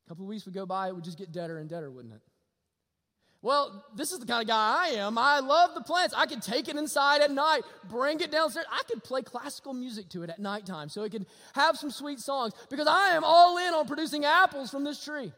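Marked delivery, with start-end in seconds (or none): 3.4-15.3 louder, higher pulpit build; full-force preaching. Acted. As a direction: drive it home to the congregation.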